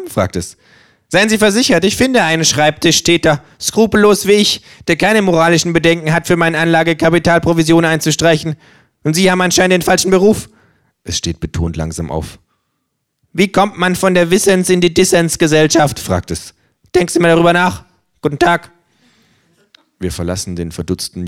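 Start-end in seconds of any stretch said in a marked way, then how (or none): 1.1-10.5 höher, fordernde Känguru-Stimme; freches Känguru. Acted. Känguru zieht Geld ab. 13.3-18.7 höher, fordernde Känguru-Stimme; freches Känguru. Acted. Känguru verabschiedet sich.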